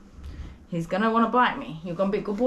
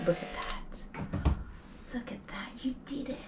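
hushed, slow whispered praise; tearful whisper; 5 semitones higher, 9 dB quieter, slower than usual. Real, not acted. Groomer whispering to a dog who finally let her finish. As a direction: barely voice it, choked up.

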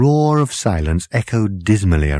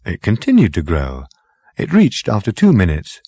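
plain reading voice

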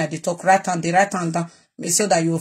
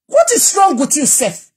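angry outburst; 7 semitones higher than usual. Real, not acted. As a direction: hurl it at Yuri, furious. Confronts Yuri angrily.

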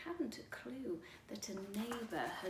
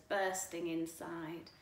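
ordinary delivery, nothing marked

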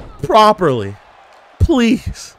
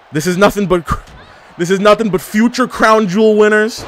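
usual speaking voice

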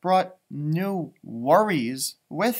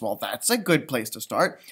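ordinary delivery, nothing marked

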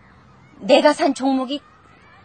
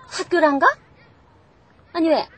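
ordinary talk

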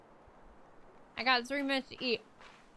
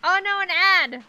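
loud, high shocked yelp; dismayed outcry; 8 semitones higher, 12 dB louder than usual. Real, not acted. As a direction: yelp as the ad hits. Ad pops up on stream.

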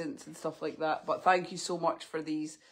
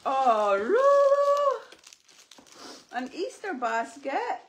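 dog talk; 6 dB louder, slower than usual. Real, not acted. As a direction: coo excitedly at the dogs. Excited voice for dogs.

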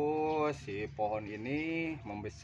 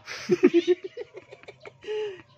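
loud laugh; 12 dB louder than usual. Real, not acted. Laughing at the joke about scratching.

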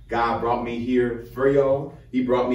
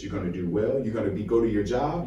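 usual speaking voice

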